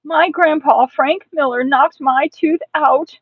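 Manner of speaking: put-on loud, high child's outcry; frantic, indignant wailing; panicked shouting. A narrator's reading. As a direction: shout the alarm to the whole house. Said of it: "I shouted".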